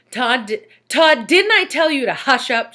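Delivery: high, loud scolding question; rounding on the pupil, loud and high; angry teacher. A narrator's reading. Teacher scolding Todd, high loud angry demand. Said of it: "she asked".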